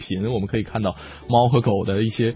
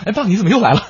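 high, laughing mock surprise; 9 semitones higher, 5 dB louder, much faster than usual. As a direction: laugh through the quote. Acted quote of surprised son.

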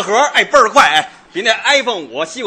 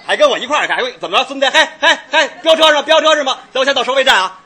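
high-pitched mock challenge to another driver; cocky road racer; 6 semitones higher, faster than usual. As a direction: taunt the car alongside. Acting a driver challenged to race. (高声挑衅旁边的司机)

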